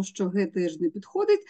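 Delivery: ordinary delivery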